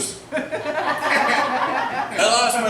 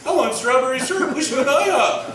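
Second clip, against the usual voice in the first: back of brain; 4 semitones higher than usual. Retold or imitated. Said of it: "Back of my brain's going".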